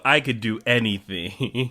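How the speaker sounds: ordinary delivery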